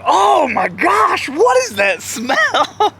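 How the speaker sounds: high, stretched-out mock disgust; appalled. People at ringside smelling it, mock appalled cry. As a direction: imitate a horrified bystander, dragging out each word.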